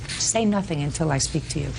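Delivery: ordinary talk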